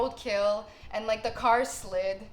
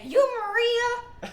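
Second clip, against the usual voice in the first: much higher, slow, put-on driver voice; groggy cab driver; 14 semitones higher, 6 dB louder, much slower than usual. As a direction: drawl it like a half-asleep driver.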